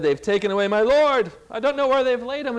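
hint of tearful alarm; mildly acted distress. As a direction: cry it out in distress, without real sobs. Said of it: "And she's crying".

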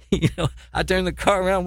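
ordinary delivery

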